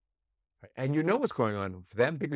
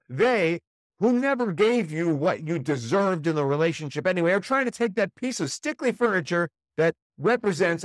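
higher and louder, building into outrage; rising indignation; 7 semitones higher, 5 dB louder than usual. Acted. Ex taking the family's things, building furious outrage. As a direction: fume as if they're robbing you. Imagined threat in a divorce negotiation.

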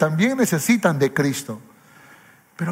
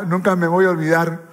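ordinary delivery

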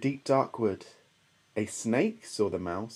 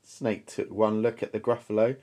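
plain reading voice

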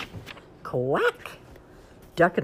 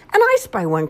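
quacked; 6 semitones higher, 10 dB louder than usual. Acted. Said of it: "quacked Duck".

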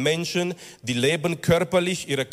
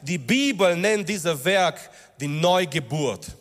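higher, driving sermon voice; impassioned preaching; 4 semitones higher than usual. Acted. Preacher urging the congregation about their rebirth, impassioned. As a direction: preach it with rising fervour. Preacher rises to full force.

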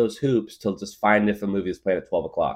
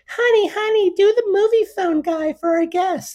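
much higher doting mom voice; mom's voice; 7 dB louder than usual. Retold or imitated. Mom asking her son to perform for a guest, high doting voice. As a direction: coax like a doting mom showing off her kid. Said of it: "my mom would always say".

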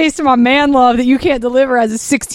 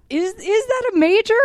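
high puzzled question; 11 semitones higher than usual. Retold or imitated. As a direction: sound doubtful and confused. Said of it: "We both were like".

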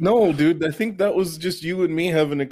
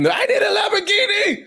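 much higher, yelping mock demand; mock shouted demand. Drop-kicking fan yelling at Arnold, high squeal.

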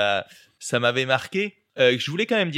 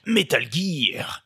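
slow, drawn-out echo of the last words; lightly voiced deadpan echo of a hardened soldier; Snake repeating; 3 semitones higher, much slower than usual. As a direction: repeat it slowly like a puzzled soldier. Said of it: "ça m'avait déjà marqué quand il fait".

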